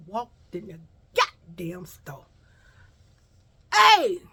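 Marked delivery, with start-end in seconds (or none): 3.7-4.2 loud, high mock confrontation; confrontational yell. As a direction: call her out across the store. Store girls confront her.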